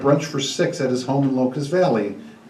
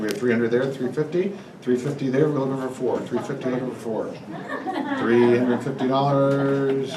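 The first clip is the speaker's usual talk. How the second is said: auctioneer calling; 5 dB quieter than usual. Acted. Charity auction bid calling.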